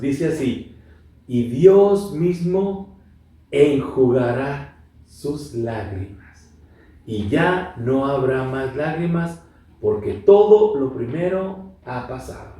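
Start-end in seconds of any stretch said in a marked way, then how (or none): 1.3-12.6 slow, measured scripture reading; lectura bíblica solemne. Acted. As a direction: read the verse slowly, letting each phrase rest. Lee pasaje bíblico con solemnidad. (lectura pausada y solemne de la Biblia)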